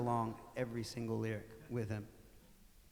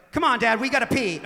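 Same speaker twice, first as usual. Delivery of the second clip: urgent excuse to dad; 11 semitones higher, 15 dB louder than usual. Retold or imitated. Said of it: "I think I was like".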